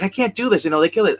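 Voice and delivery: high-pitched mock pleading; retold distressed protest. Informant begging out to her handler, retold distressed protest. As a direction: act out a reluctant informant, voice jumping up.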